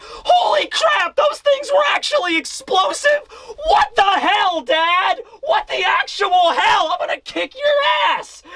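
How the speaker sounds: very high, wailing mock outrage; Yamato crying. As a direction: shriek tearfully at your dad. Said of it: "Yamato is crying, like".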